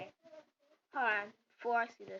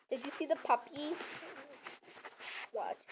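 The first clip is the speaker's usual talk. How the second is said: ordinary talk, nothing marked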